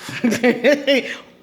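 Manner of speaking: short high burst of laughter. Real, not acted. Laughing at the woman's foot-in-mouth remark.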